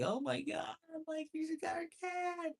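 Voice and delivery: very high gushing squeal; mock fawning passengers cooing at a cat; gushing fan voice. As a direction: coo like a smitten fan over a pet. Mocking fawning train passengers.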